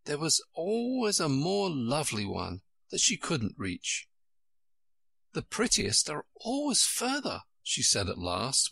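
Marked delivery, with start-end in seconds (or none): 5.3-7.4 put-on high, wistful girl's voice; mild childlike disappointment; said with a sigh. A narrator's reading. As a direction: lament lightly in a high voice. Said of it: "with a sigh at the obstinacy".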